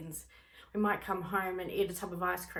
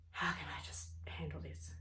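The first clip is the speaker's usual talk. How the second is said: soft whispered self-talk; hushed inner calming; 3 semitones lower, 7 dB quieter than usual. Pretend. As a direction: whisper it to yourself to settle down.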